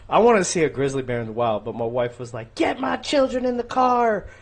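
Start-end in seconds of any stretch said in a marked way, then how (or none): 2.5-4.3 imitated shouting. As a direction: imitate a wife shouting, high and stretched. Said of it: "Like, shouting".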